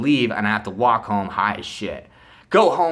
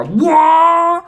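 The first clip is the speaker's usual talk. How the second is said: loud, high "waah" of a revving bike; long drawn-out engine whine; engine roar imitation; 10 dB louder than usual. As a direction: mimic a dirt bike taking off.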